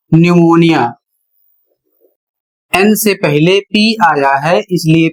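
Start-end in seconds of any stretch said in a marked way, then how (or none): 0.1-0.9 न्यूमोनिया said with silent p, nyoo-mo-ni-ya; correct pronunciation. Shown as a demonstration. Teacher models correct pronunciation for students. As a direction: say न्यूमोनिया without the p sound. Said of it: "और इसका उच्चारण होगा".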